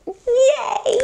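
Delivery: high little yay; happy cheer. Real, not acted. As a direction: cheer for the end. Cheer after story ends.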